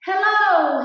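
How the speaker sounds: high, loud hailing hello; calling out greeting. Acted. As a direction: call out a big hello to someone. Rabbit calls out to Otter.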